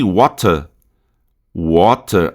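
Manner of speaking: "water" British, crisp T and no final R; British English accent. Shown as a demonstration. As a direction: end British "water" on a weak vowel. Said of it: "Em inglês britânico".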